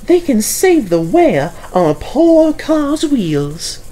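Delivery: high, slow, put-on car voice; a prim old car, pitched high and drawn out; Caroline's voice. A narrator's reading. Caroline admitting trains are useful, high and slow. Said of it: "she says".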